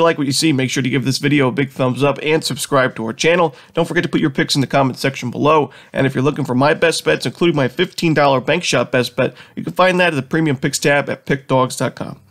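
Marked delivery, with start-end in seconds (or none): none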